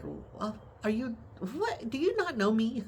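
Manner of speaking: high, squeaky-pitched disbelief; retold incredulity. Caregiver retelling her reply to the doctor, high-pitched incredulity. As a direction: reenact being floored, pitch jumping way up.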